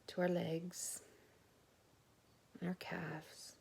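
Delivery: slow, softened meditation voice with long pauses; guided body scan. Real, not acted. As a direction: guide them gently from one body part to the next.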